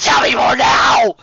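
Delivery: frantic shout; roar. Acted. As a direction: roar the demand. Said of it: "Twilight roared".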